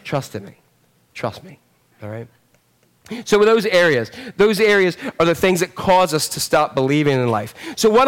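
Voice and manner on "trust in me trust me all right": much softer and lower, tender; whisper-close promise of trust; soft reassurance. Retold or imitated. God tenderly asking his people to trust him, hushed.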